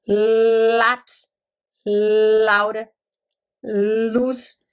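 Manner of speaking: "Lápis", "Laura", "luz" with a full tongue-tip L; clear l sound. Shown as a demonstration. Teacher modelling the initial L sound. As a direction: show a real L at each word start. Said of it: "Porque o L tem L".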